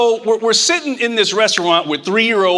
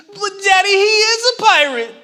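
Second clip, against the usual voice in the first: put-on high, pleading child voice; little girl voice; 10 semitones higher than usual. A toddler insists to her daddy in a high, tearful child voice.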